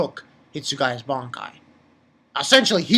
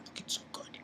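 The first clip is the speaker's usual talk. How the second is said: whisper; 20 dB quieter, faster than usual. Real, not acted. Joking aside about looking.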